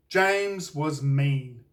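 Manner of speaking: plain reading voice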